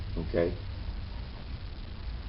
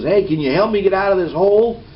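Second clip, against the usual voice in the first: higher, louder call up out of a hole; yelling up; 8 semitones higher, 5 dB louder than usual. Retold or imitated. Man stuck in a hole yells up to a passerby, louder and higher. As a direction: call up to him from down in the pit. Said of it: "he yells up and he says".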